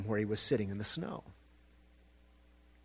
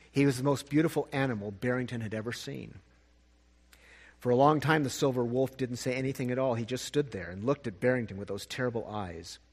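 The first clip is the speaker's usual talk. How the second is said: ordinary delivery, nothing marked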